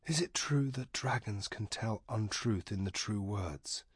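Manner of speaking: quietly asking, half under breath; soft question. A narrator's reading. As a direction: ask softly so as not to wake him. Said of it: "asked the Pelnish wizard softly".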